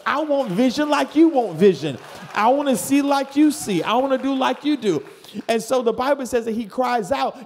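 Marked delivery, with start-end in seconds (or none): 0.1-5.0 high, loud preaching cry; impassioned shout. Real, not acted. Preacher crying out to congregation for vision, loud. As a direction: shout it out with fervour.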